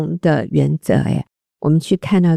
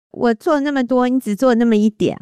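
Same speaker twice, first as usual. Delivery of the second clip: higher-pitched imitation of keeping score; mock complaint; 7 semitones higher than usual. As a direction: grumble like a spouse comparing chores. Acting a wife's grumbling comparison. (假装抱怨计较)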